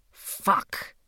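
slow, heavy, barely voiced curse; groggy hungover whisper; ponderous hoarse whisper. A narrator's reading.

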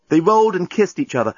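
ordinary delivery, nothing marked

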